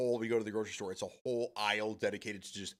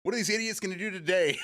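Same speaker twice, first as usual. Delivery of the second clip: high, slow, thrilled cheer; hyped excitement; 12 semitones higher, 6 dB louder than usual. Retold or imitated. Fan psyched as the Jackass theme starts, excited. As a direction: pump yourself up for the show. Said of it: "You're like".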